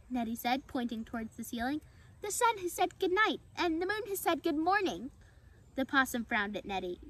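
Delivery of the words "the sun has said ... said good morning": high, singsong squirrel voice; cheerful. A narrator's reading. Squirrel pointing at the sky, cheerful high voice. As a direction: chirp it cheerfully at a high pitch. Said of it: "You're so cheerful about".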